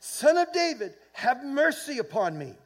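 high, loud pleading call; crying out. Acted. Blind beggar calls to Jesus, crying out. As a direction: call out over a crowd for mercy. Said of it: "he cries out".

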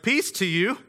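light and casual, lifting at the end like a hello; an easygoing greeting with an upturn; casual Jesus greeting. Retold or imitated. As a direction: toss it off as a friendly hi to the guys. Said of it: "he's like".

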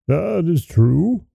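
slow, heavy ogre voice; a lightly voiced ogre, drawn out. A narrator's reading. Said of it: "answered the ogre very briskly".

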